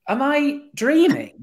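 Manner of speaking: high, loud, drawn-out incredulous question; retold disbelief. Storyteller recalling the football in the trophy room, incredulous. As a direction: stretch it out in astonished disbelief. Retelling his amazed reaction to trophy.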